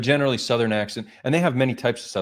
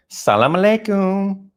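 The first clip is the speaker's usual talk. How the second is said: drawn-out, high, camp greeting; most sissy; 11 semitones higher, 5 dB louder, slower than usual. Shown as a demonstration. Testing the sissy accent on a greeting, as sissy as possible. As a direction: camp it up, stretching the words high and loud. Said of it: "I went the most sissy I could go".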